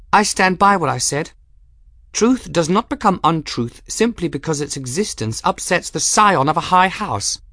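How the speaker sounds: put-on high-pitched, composed young woman; Bridget, firm and formal. A narrator's reading. Young woman answering the master of arms, firm. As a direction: state the case firmly, pitched high.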